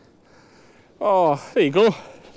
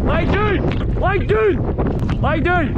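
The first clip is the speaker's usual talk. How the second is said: high, carrying dog command called across the hill; calling to dogs; 12 semitones higher, much slower than usual. Real, not acted. Shepherd calling his dog to lie down, high and carrying. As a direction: call it out so the dog hears you far off.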